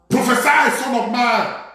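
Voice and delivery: PROPHESY shouted high and loud; emphatic command. Real, not acted. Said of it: "I like the emphasis".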